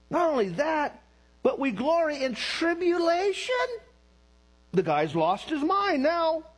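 very high, comic disbelief; incredulous protest. Retold or imitated. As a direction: squeal each phrase in mock outrage at the verse. Acting shocked reaction to the verse.

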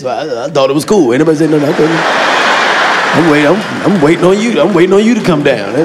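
low sheepish mumbling excuse; dumb boyfriend excuse voice. Retold or imitated. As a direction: play the caught boyfriend making excuses. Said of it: "the answers we give you".

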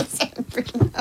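breathless giggle, barely voiced; laughing. Real, not acted. Host repeating co-host's muddled phrase, helpless with laughter. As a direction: squeeze the words out softly through laughter.

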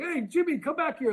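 very high mock call to a coworker. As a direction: imitate an excited guy summoning his buddy. Acts out stranger calling a coworker over.

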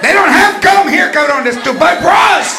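high, loud exasperated rant; grandma ranting. Retold or imitated. Grandma ranting at grandson about bras. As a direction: vent at the kid.

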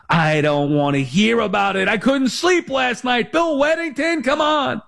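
high, strained complaint climbing as it goes; exasperated mock yell. Sick Knicks fan venting at his teacher, high exasperated mock yell. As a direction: act out a sleepless fan's outburst, pitch shooting up.

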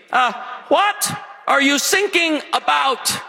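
put-on slow, high, puzzled German accent; mock German accent, drawn out; German coast guard trainee. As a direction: ask it like a baffled German rookie. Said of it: "trained day one on the German Coast Guard".

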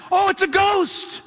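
high, startled shout of alarm; frightened cry. Retold or imitated. Disciples in the boat seeing a ghost, frightened shout. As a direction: cry it out like terrified sailors. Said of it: "So they cried out for fear".

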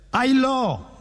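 high, drawn-out "Ay, lo" with the P missing; "Ay, lo" in a high drunken wail, P dropped. Acted. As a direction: slur "Ay, lo", unable to make the P. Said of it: "No podía pronunciar la P".

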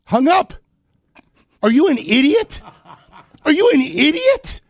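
high, loud incredulous outburst; outraged shouting. Real, not acted. Host fuming after the caller hangs up, outraged shout. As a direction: yell it in real disbelief.

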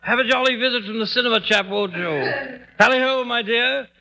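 high, exaggerated English toff; sarcastic mock-English farewell, pitched high; mocking British accent. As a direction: throw a hammed-up British accent back at him. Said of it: "when you pick a fellow with a British accent".

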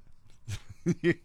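short laugh escaping; real amused laugh. Real, not acted. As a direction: let a brief laugh slip out. Laughs after reading the statement.

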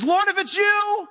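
Peter's plea. Retold or imitated. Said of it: "and he says".

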